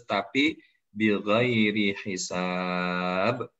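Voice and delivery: "bighairi hisab" drawn out very slowly; emphasis on bighairi hisab. Shown as a demonstration. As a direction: stretch "bighairi hisab" for weight. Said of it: "ini penekanannya adalah".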